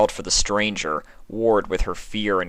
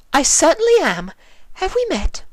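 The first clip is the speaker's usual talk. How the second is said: much higher, bright lady's voice; Rarity voice; 5 dB louder than usual. Acted. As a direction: play the gracious shop owner.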